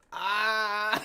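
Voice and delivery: loud, high imitated groan of pain; mock groan. Retelling being too sore to get out of bed, a loud mock groan. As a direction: act out a groan from sore muscles. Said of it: "I said".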